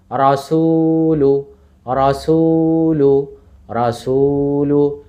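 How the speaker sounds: রাসূলু slow, with a long held সূ; stretched recitation. Shown as a demonstration. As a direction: sustain the middle vowel of রাসূলু. Demonstrating the long vowel slowly.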